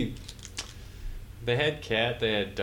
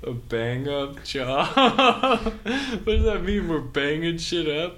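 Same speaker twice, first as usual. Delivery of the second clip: high, loud laughing mock outrage; laughing disbelief; 6 semitones higher, 5 dB louder than usual. Real, not acted. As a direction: tease the slang with a burst of laughter. Mocking the British slang.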